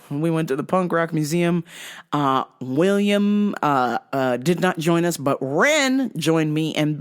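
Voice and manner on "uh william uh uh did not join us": ordinary talk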